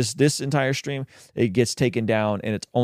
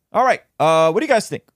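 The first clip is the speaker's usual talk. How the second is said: high excited; 6 semitones higher, 5 dB louder than usual. Real, not acted. Sudden high exclamation.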